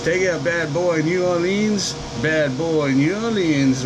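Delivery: usual speaking voice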